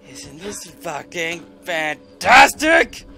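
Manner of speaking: slow, loud sarcastic yell; sarcastic shout. Real, not acted. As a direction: spit the sarcasm out.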